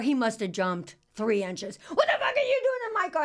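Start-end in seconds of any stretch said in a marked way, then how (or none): 1.9-3.2 high-pitched panicked shriek; shrieking yell. Retold or imitated. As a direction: shriek it again in shock. Repeated shouted exchange.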